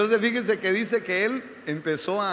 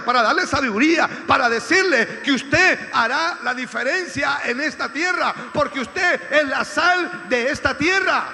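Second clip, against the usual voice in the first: predicación a plena voz; 7 semitones higher, 8 dB louder than usual. Real, not acted. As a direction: preach it forcefully, stretching the key words. Preaches at full force. (predicación enérgica, fuerte y alargada)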